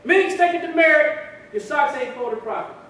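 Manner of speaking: high, loud barked reprimand; drill instructor. Acted. Instructor to Meeks over his socks, barked reprimand. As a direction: shout it like a training instructor. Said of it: "The T I would say".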